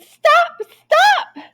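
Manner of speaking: high, loud scream of stop; old woman screams at the escaping cookie, high and loud. Acted. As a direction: shriek it after the runaway cookie. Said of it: "The little old woman screamed".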